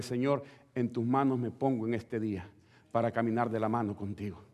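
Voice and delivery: soft, intimate morning prayer; quiet prayer. Shown as a demonstration. Believer softly entrusting the day to the Lord, hushed. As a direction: murmur it softly to God at dawn. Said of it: "dígale".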